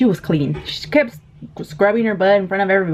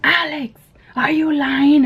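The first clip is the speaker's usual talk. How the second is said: suspicious mom; 9 semitones higher, slower than usual. Retold or imitated. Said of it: "her mom goes".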